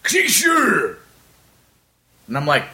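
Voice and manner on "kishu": high-pitched mock outburst from a big stranger; big customer imitation. Big scary man points at the caricature artist, imitated garbled high call. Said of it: "he kinda points and goes".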